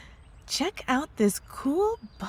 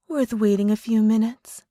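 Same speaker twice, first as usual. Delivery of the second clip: normal talking voice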